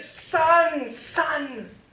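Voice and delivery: high, loud, overjoyed cry; a father embracing his lost son. Acted. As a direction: call his name in tears of joy.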